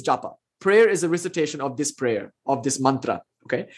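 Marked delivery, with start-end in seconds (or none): none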